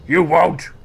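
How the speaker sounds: loud, high taunting shout; downed fighter taunts the ogre, shouting; defiant taunt. Acted. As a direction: dare him to finish it. Fallen hero taunts the ogre.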